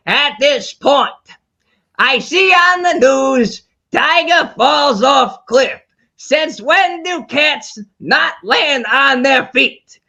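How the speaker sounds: slow, squinting nasal screech; raspy comic shriek; Gilbert Gottfried voice. Retold or imitated. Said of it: "And so I was Gilbert Gottfried".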